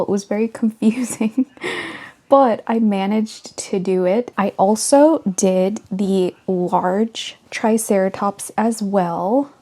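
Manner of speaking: normal talking voice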